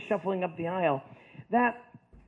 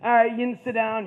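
loud coach calling class to order; football coach; 3 semitones higher, 7 dB louder than usual. Retold or imitated. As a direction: settle the class like a substitute coach. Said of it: "he normally came in, he's like".

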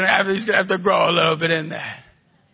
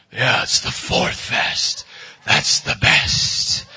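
mock fan voice; 11 semitones lower, much slower than usual. Mimicking someone praising the fest.

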